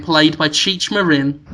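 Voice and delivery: usual speaking voice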